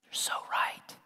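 hushed, voiceless mock agreement; mock awed whisper. Child conceding Dad's great argument, mock awed whisper. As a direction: whisper it as the pretend convinced child.

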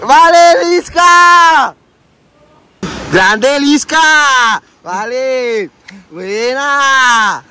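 high drawn-out cheer; cheering shout. Real, not acted. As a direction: call out in praise. Cheering after the jump.

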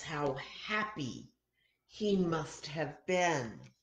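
ordinary delivery

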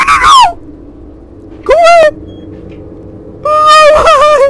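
very high, loud panicked pleas; real terror; desperate screaming. Real, not acted. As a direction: shriek at it to go away. Monster closes in, screaming.

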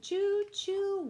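high, loud sung choo choo; a playful whistle for the kids; train whistle choo. Acted.

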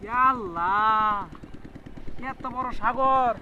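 slow, awed exclamation. Acted. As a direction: marvel out loud, slowly.

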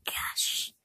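breathy whispered gosh. Real, not acted. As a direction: whisper it under your breath. Whispered aside.

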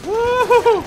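whooping cheer. Real, not acted. Cheering mid-fight.

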